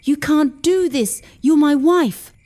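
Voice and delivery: ex-husband pounding on the door, shouting at his wife. Acted. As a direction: yell it through the door, furious.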